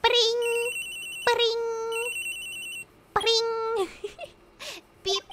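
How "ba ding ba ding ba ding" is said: high, monotone ringing noise; imitated phone ring. Phone ringing in Phoenix's bedroom, high imitated ring. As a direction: sing out a ringtone with the mouth.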